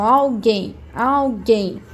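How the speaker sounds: alguém slowly, open GUÉM stressed; stress on last syllable. Shown as a demonstration. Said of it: "falo mais aberto".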